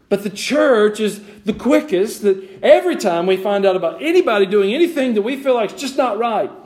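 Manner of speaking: high, loud rebuke from the pulpit; impassioned preaching. Real, not acted. Preacher rebuking a church quick to judge, high and forceful. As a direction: hammer the accusation home.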